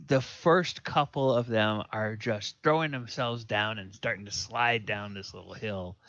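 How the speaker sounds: usual speaking voice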